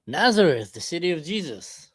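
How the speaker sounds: ordinary talk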